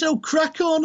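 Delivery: high-pitched pantomime Brummie; mock a Brummie accent, pitched right up. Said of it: "mock pantomime Brummie character of".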